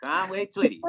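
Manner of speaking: playful high-pitched granny impression; mock old grandma. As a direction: squeak it out like a delighted old grandmother. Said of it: "She was".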